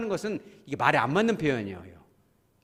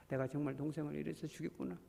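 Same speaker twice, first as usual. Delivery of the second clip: soft confession; 11 dB quieter than usual. Retold or imitated. Cain's hoped-for confession.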